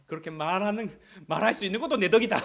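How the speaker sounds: loud, fast, laughing retort; mock-angry parent snapping back; scolding parent. Parent scolding a child, loud and laughing. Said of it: "이렇게 하면서 때려줘야 돼".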